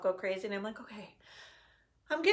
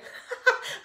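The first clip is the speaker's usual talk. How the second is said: breathy laugh through tears; teary laugh; 3 dB louder than usual. Real, not acted. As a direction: laugh off the emotion. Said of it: "this weird emotional lady".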